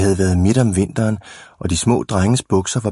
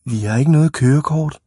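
put-on high-pitched small-boy voice; meek little boys; 7 semitones higher than usual. A narrator's reading. Small boys admit they have no licence, meek. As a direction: own up in a high child's voice.

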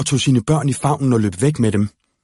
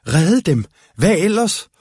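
shouting; 7 semitones higher than usual. Acted. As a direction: shout it as she jumps up. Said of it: "råbte Følfod".

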